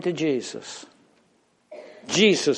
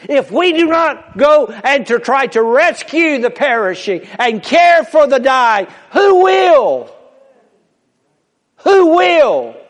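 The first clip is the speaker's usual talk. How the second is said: high, loud pleading call; preaching at full force; 10 semitones higher, 9 dB louder than usual. Real, not acted. Preacher pleads with the congregation, high and loud. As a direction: cry out to the church to act.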